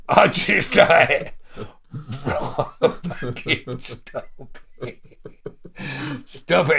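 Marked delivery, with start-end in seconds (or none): 2.2-6.8 laughing. Retold or imitated. Laughing, pleading in fear.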